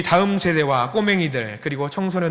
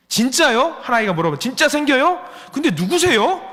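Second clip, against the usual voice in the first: put-on curious teens; 9 semitones higher, 5 dB louder than usual. Teens' surprised questions voiced.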